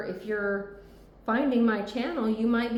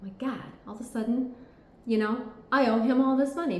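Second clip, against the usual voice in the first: quick, higher, half-acted gasp of dismay; mock surprise; 5 semitones higher, 3 dB louder, faster than usual. The ex caught out, imitated in a fast mock panic. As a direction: play the ex suddenly realising they owe money. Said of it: "This one's surprised about".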